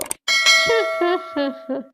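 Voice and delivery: a short, satisfied chuckle at her own trick; pleased laugh. Acted. Mother-in-law laughing after revealing her clever plan, pleased chuckle. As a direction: laugh knowingly, pleased with yourself.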